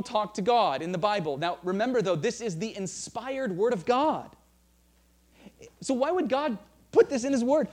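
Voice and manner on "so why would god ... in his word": emphatic rhetorical question. Real, not acted.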